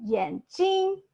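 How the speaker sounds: 眼睛 with 睛 held high and level; first tone on 睛. Shown as a demonstration. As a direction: stretch 睛 out on a high, even pitch. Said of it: "So the 眼睛 is eyes".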